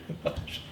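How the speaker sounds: short quiet chuckle; soft laugh. Real, not acted. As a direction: let a little laugh slip out. Moved, talking about home.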